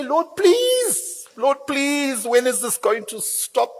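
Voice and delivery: high pleading prayer of an exhausted father; desperate tired dad. Pretend. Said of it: "you're hearing this crying and you say".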